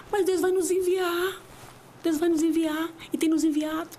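high, tearful voice breaking as she speaks; fighting back real tears; crying. Real, not acted. (voz embargada de choro)

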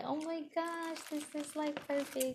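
hushed, high, delighted gasp; quiet amazed joy; softly awed. Retold or imitated. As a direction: breathe it out in wonder. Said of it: "I was like".

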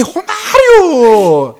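long, drawn-out, high football yell; hammed-up name-shouting. Driver echoes the passenger's player name, long-drawn mock roar. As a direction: stretch the name like a commentator's goal cry. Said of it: "Uma gritaria de nome".

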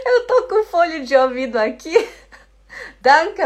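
loud, high, laughing as you talk; real laughter. Real, not acted. Teacher hears husband's audio in her headphones, laughing. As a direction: giggle through the explanation.

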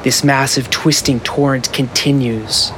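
steady scene-setting narrator; story narration. Acted. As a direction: paint the scene for the players. Game master's scene setting.

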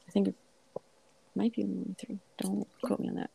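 whispered quick aside; whispering. Real, not acted. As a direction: drop to a whisper for the disclaimer.

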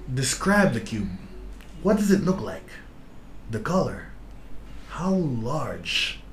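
slow, calm guided visualization; guided meditation voice. Acted. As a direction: paint the scene calmly, with pauses. Reads the test like a guided meditation.

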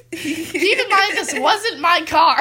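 slow, loud, about to crack up; barely holding back laughter; amused emphasis. Real, not acted. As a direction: draw it out high and loud. Cracking up at own confession.

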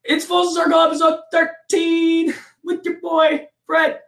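high, slow shouted announcer intro; third take hype intro. Acted. Host nailing the intro on the third try, shouted announcer style. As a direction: stretch it out like a ring announcer. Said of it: "All right".

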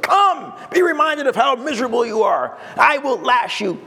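high, declaiming mock preacher; mock punishing church voice; hammed-up stern zealot. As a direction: summon the sinners with theatrical severity. Mimics a stern miserable-making church.

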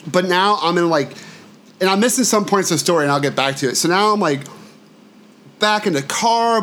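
normal talking voice

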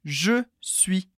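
"Je suis" with both vowels full and clear; slow model of "je suis"; slow and careful. Shown as a demonstration. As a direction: say "je suis" slowly, every sound in place. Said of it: "Repete bem devagar".

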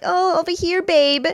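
high, sugary call across the room; fake sweet greeting. Mei calling her boyfriend over in a high fake-sweet voice. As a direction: pile on the fake affection.

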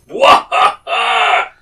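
long, loud, high battle roar; battle cry. Acted. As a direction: rise from the ground with a drawn-out war cry. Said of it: "And go".

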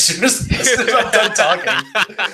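laughing through the words; words broken up by laughter. Real, not acted. Laughing at his own hiccups.

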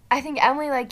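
usual speaking voice

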